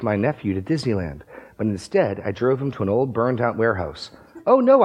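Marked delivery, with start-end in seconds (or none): 4.5-4.8 high loud cry of dismay; cried out. Acted. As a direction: fake alarm, high and loud. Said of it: "I cried".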